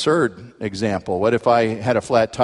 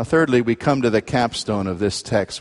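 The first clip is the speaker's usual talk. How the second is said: normal talking voice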